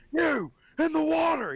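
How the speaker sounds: high, loud cop command; cop shouting. Retold or imitated. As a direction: bark it across the lake at the poacher. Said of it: "he's like".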